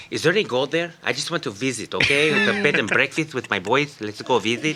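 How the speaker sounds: high, lisping conquistador put-on; mock Spanish lisp, hammed up; Cortez. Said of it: "Cortez is like".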